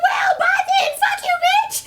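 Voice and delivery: very high shouted insult; mock shout.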